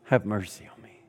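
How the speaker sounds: soft, low cry for mercy; a low, hushed beggar's plea for mercy; quiet plea. Acted. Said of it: "he said".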